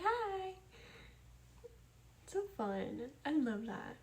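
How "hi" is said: high-pitched drawn-out greeting, imitating a friend; imitated high "Hi"; Winter. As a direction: mimic a friend's high hello. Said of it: "Winter's also like".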